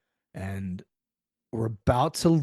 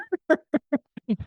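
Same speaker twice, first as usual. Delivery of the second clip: high laugh. Real, not acted. Laughing at the McDonald's excuse, high and bright. Laughing at his own story.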